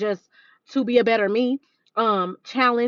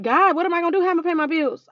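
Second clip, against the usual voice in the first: worried plea to God; 7 semitones higher, 3 dB louder, faster than usual. Retold or imitated. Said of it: "And I'm like".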